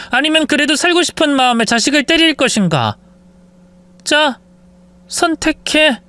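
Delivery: high, taunting ultimatum; menacing wife. Acted. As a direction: give her a deadly choice. Wife forces a choice.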